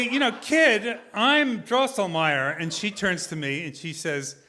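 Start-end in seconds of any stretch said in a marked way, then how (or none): 0.0-2.6 angry, competitive. Retold or imitated. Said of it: "I'm getting kinda angry and competitive".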